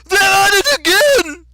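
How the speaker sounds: very high, drawn-out yell; full-lung shout. A narrator's reading. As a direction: shout it at the top of your lungs. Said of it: "shouted at the top of his lungs".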